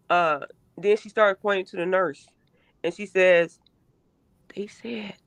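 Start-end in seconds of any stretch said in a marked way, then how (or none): 4.5-5.1 voiceless whisper. Retold or imitated. Mother voicing her daughter's secret whisper about the nurses. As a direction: drop to a hushed, secretive whisper.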